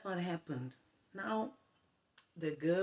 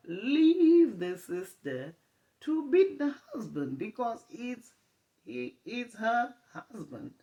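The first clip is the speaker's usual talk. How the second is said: much louder, higher, slow mock pastor shout; 8 semitones higher, 11 dB louder, slower than usual. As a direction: bellow the pastor's punchline slowly. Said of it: "The pastor says".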